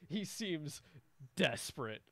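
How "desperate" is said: normal talking voice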